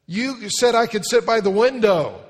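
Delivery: put-on high, loud pleading little girl. Preacher acting a girl begging for the window seat, loud and high. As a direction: whine it like a seven-year-old wanting the window.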